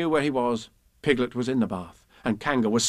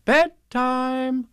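high, loud singsong announcement; lilting, raised mother's call; motherly Kanga; 13 semitones higher, 5 dB louder than usual. Acted. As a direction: call bedtime out brightly. Kanga announces bedtime cheerfully.

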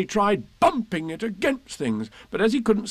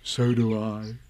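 tender; 7 semitones lower, slower than usual. A narrator's reading. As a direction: answer fondly, deep in your voice. Pooh answers tenderly.

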